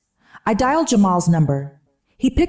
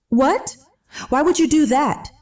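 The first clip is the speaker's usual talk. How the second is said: sharp, high angry hiss; 9 semitones higher than usual. A narrator's reading. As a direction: hiss it out in alarm. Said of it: "he hissed".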